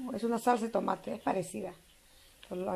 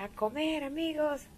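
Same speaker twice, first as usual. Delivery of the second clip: calling out; 6 semitones higher, slower than usual. Retold or imitated. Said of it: "y decía".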